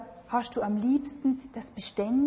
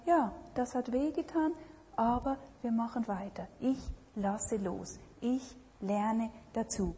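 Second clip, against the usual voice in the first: softer, calm and conciliatory; calm letting go; 2 semitones lower, 8 dB quieter than usual. Retold or imitated. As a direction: gently make peace and let it go. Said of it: "Oder können wir sagen". (leise, versöhnlich, ruhig)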